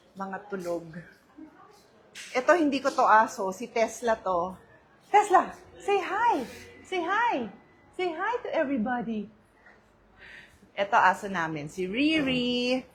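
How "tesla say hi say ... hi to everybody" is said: high coaxing pet voice. Real, not acted. Owner showing Tesla to viewers, high coaxing pet talk. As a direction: coax a pet in a raised pitch.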